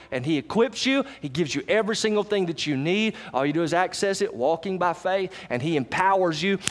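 ordinary talk, nothing marked

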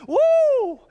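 loud, very high "woo"; excited whoop. Acted. Fisherman with a bass on the line, excited whoop. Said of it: "start getting all fired up and smiling".

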